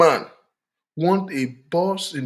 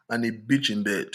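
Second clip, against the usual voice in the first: ordinary talk, nothing marked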